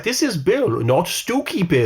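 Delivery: high, drawn-out showman's introduction; hammed-up high presenter; Toymaker puppet-show impression. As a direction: present the puppet theatrically, slowing at the end.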